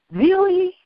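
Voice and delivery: high-pitched, smiling "really?"; smiling disbelief. Retold or imitated. Pilgrim to gunman she pushed aside, smiling disbelief. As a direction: toss it out high, amused and unafraid. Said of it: "smiled and just said".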